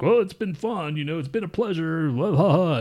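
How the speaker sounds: lightly imitating a coworker's polite goodbye; Bruce's farewell speech. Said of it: "Bruce is like".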